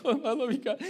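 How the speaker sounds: high, laughing imitation of sobbing; mock crying. Dad waving goodbye to his family, mock crying. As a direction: wail it tearfully, half laughing. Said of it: "I'm crying my eyes out".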